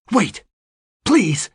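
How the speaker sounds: high, desperate pleading; begging. Acted. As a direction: plead for mercy in fear. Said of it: "begged Larkspur".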